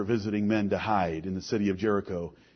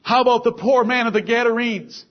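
higher, louder preaching rising through the list; preaching at full force; 8 semitones higher, 6 dB louder than usual. Acted. Preacher rousing the congregation with saved sinners, full force. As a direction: preach it out, each name hitting the congregation. Preacher listing apostles, demoniac.

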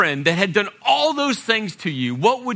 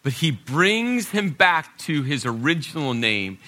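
ordinary delivery

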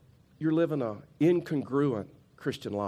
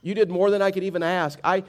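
louder, insistent preaching to the congregation; 7 semitones higher, 8 dB louder than usual. Acted. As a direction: press the point home from the pulpit. Preacher driving home his point.

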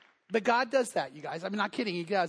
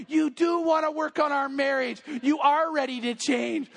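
higher-pitched, emphatic wifely astonishment; acted amazed wife; 5 semitones higher than usual. Wife delighted that the bed is made.

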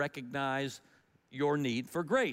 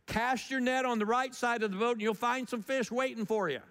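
calling out; 7 semitones higher than usual. Retold or imitated. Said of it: "shouts back".

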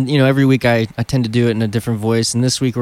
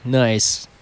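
ordinary delivery

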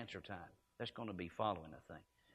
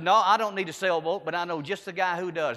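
louder, higher, bursting with news; excited retort; 5 semitones higher, 8 dB louder than usual. Retold or imitated. Preacher retelling his excited answer to a friend. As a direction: throw it out with glee.

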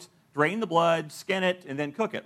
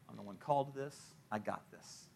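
hushed, much softer, lower; inner voice; 7 semitones lower, 13 dB quieter than usual. Retold or imitated. Believer's secret inner boast, hushed. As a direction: mutter it privately to yourself. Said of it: "we do on the inside".

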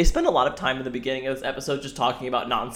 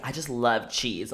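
sultry voice; 3 semitones lower, 7 dB quieter, slower than usual. Retold or imitated. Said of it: "weird sultry voice".

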